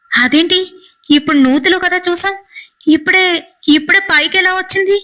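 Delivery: a storyteller voicing a scared friend; frightened. A narrator's reading. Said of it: "అని భయపడిపోతూ".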